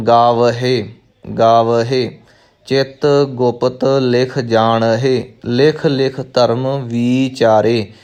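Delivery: slow spoken recital, ਗਾਵਹੇ with an audible "-he"; clearly sounded h. Shown as a demonstration. Teacher drilling new learners, careful "he" ending on ਗਾਵਹੇ. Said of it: "ਹਾਹੇ ਦਾ ਜੋ ਉਚਾਰਨ ਹੈ ਉਹ ਸ਼ੁੱਧ ਹੋਵੇਗਾ".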